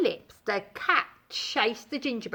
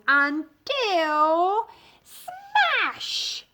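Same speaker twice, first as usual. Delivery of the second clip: dramatic smash; 6 semitones higher, 3 dB louder, much slower than usual. A narrator's reading. Dramatic narration climax.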